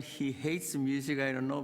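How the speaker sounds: ordinary talk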